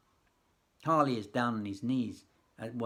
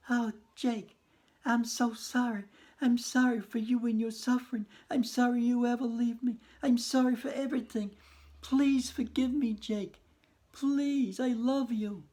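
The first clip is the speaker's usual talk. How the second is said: high, pleading widow's voice; 11 semitones higher, 3 dB louder than usual. Acted. Widow begging Jake to forgive her, high and pleading. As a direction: beg forgiveness in a high, tearful widow's plea.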